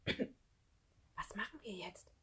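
slow whisper, as soft as possible; very hushed, anxious question. Acted. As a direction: barely breathe it to a friend. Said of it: "flüsterte Lukas so leise wie möglich".

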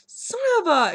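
high, loud hammed-up outrage; mock ticked off. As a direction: play-act being furious, all in jest. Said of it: "joking around about being ticked at me".

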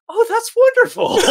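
very high, loud, gushing parody; mock sudden delight. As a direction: flip into hammed-up joy. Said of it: "immediately at the drop of a hat".

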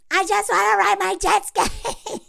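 high, sing-song whine of a carefree guy; hammed-up giddy Jeremy. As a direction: stretch it high like a kid begging for a toy. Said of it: "he's all like".

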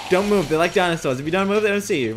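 high, loud panicky advice; panicked warning. Real, not acted. Player urging stillness before the threat, panicked warning. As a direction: blurt it loud and high, in alarm.

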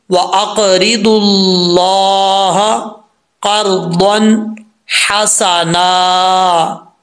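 very slow chant, दुल joined straight to लाह; correct recitation. Shown as a demonstration. Teacher demonstrating the verse for students, slow and chanted. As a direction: draw out अक़रिदुल्लाह क़र्ज़न हसना in recitation. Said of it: "तो कैसे पढ़ेंगे?".

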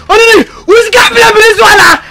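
crying shout. Acted. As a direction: plead through sobs, shouting. Same question now in tearful panic.

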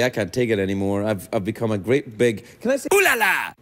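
ordinary talk

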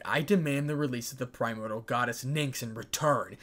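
ordinary delivery, nothing marked

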